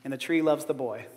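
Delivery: gentle read-aloud storytelling; story narration. A narrator's reading. Preacher reads the children's book to the congregation, gentle storytelling.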